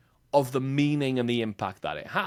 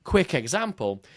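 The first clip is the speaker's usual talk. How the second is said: ordinary talk, nothing marked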